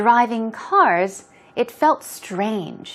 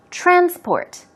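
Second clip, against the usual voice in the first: TRANsport, first syllable high and falling; "Transport" as a noun, high TRAN dropping away on -sport; stress on first syllable; 8 semitones higher than usual. Shown as a demonstration. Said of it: "stress goes on the first syllable".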